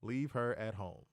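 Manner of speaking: "Leave her at home" word by word, low and soft; slow deliberate emphasis. Real, not acted. Host advising co-host, "Leave her at home" said slowly, deliberately.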